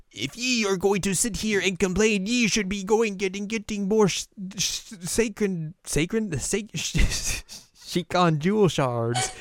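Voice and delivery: put-on high, scolding old woman voice with archaic ye. Old Kaede scolding Inuyasha for complaining, high cranky voice. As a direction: nag like a cranky old village priestess.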